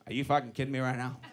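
low, soft and fast incredulous mutter; quiet disbelief. Retold or imitated. The other driver challenging me over the parking spot, quietly incredulous. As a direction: keep the challenge low and hurried.

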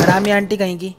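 ordinary talk, nothing marked

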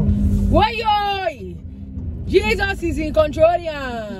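high, drawn-out wailing plea; playful panicked outburst. Pretend. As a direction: cry out dramatically to Jesus, stretching the words. Mock-panicked outburst while manoeuvring car.

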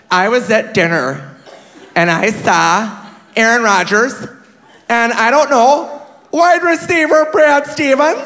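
slowing, rising, unsure recollection; poor old woman. Retold or imitated. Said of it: "this poor old woman".

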